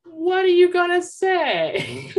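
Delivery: sister laughing. Retold or imitated. Mother voicing the older sister laughing at the question. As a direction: giggle it out as the curious sister. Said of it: "looked over at her and said".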